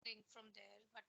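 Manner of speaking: very faint, far from the mic; almost inaudible, faint murmur; barely audible. Real, not acted. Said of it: "Your voice is very low".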